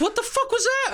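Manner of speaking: jolted by the noise; shocked. Retold or imitated. Driver to grandmother, high shocked question. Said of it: "I'll be like".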